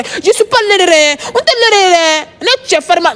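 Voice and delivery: high, drawn-out whine of refusal; high-pitched exasperated protest; wailing protest. Real, not acted. Protesting the advances still.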